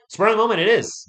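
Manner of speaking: loud, high burst of excitement; loud, high excited cry. Real, not acted. Excited fan exclamation.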